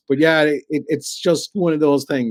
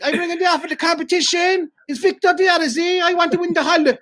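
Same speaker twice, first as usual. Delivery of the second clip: mock caller; 4 dB louder than usual. Caller voiced in radio prank.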